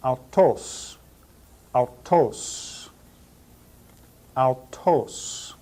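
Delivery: "autos" said ow-TOSS, each take set apart; Greek pronunciation demo. Shown as a demonstration. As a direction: model "autos" in Greek, stress on the second syllable. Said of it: "We will pronounce this word in Greek".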